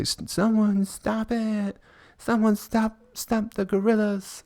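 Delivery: high shrill panicked mock woman's voice; screaming lady. Comedian mocking zoo lady, high panicked woman voice. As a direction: imitate a hysterical lady begging someone to stop it. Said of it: "she's just like".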